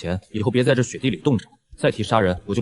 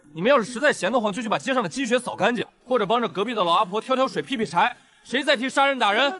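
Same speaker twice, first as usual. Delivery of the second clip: calling out to crowd; 11 semitones higher than usual. Acted. Addressing crowd loudly.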